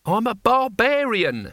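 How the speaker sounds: high, drawn-out proud cry; defiant shout. A narrator's reading. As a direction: shout it back at the lord. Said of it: "shouted Cohen".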